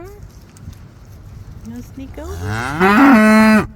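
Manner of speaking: drawn-out teasing. Real, not acted. Farmer teasing a shy calf in a slow, drawn-out singsong. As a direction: stretch it out to coax the calf.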